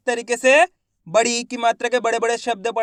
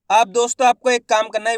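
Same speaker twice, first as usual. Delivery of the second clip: ordinary talk, nothing marked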